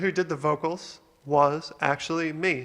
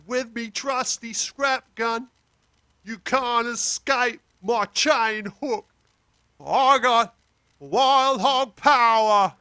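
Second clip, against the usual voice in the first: slow, raised-pitch Australian character voice; put-on Australian accent; 6 semitones higher, slower than usual. Raw vocal before effects are added, slow Australian accent. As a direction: draw it out in a hammed-up Australian accent. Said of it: "put on a bit of an Australian accent".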